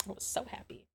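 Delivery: soft breathless whisper; hushed, dreamy whisper; whispered happy. Real, not acted. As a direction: whisper it like a secret delight. Repeating happy line, whispered.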